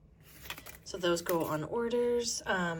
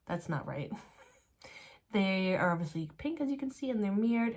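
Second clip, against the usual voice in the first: usual speaking voice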